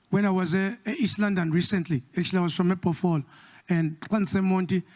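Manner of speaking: ordinary talk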